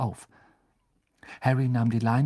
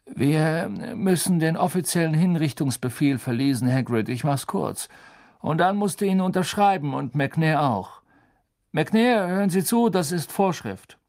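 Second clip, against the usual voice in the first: lightly voiced higher-pitched official; slightly raised, formal bureaucrat's voice; brisk official insisting on procedure; officious minister; 6 semitones higher, 4 dB louder than usual.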